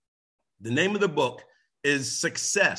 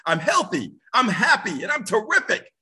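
rising, louder, energetic affirmation; with energy; 6 semitones higher, 4 dB louder than usual. Shown as a demonstration. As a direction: say it like you mean it. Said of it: "say it with energy ... say it like you mean it".

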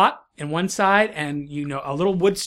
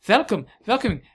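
put-on higher-pitched, swooping German accent; 5 semitones higher, 4 dB louder than usual. Tower's owner greeting unexpected dragon visitor, German accent. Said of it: "you're hearing German, German, German, German".

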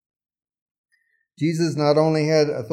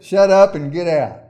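stern command; 7 dB louder than usual. Acted. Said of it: "He said".

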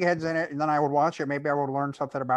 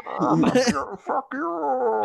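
Bane voice; 7 semitones higher, much slower than usual. Retold or imitated. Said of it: "It's like if you were doing Bane's voice".

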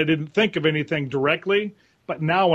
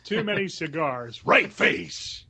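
put-on gruff old Marine; 2 semitones higher, much slower than usual. Shown as a demonstration. Guest showing his old Marine character barking an order. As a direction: bark an order like a tired old sergeant. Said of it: "affecting a voice like this".

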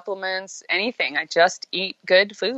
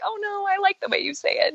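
doting parent; 12 semitones higher than usual. Retold or imitated. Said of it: "part of you's like".

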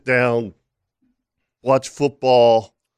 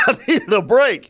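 laughing; 12 semitones higher than usual. Real, not acted. Co-host echoes joke, laughing.

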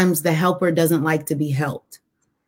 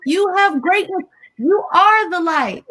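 Les Brown voice; 10 semitones higher than usual. Retold or imitated. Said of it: "says Liz Brown".

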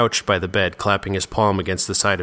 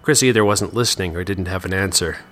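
ordinary delivery

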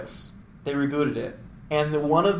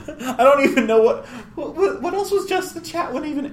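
words through real laughter, pitched up; giggling at her own joke; laughing; 11 semitones higher than usual. Real, not acted. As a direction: laugh while getting the words out. Laughing at own obscure reference.

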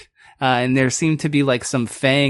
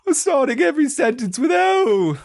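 character warm-up voice; 12 semitones higher than usual. Shown as a demonstration. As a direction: show off a silly high character voice. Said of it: "I do that for all of my voices".

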